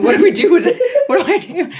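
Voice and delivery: laughing mock panic. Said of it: "where I'm like".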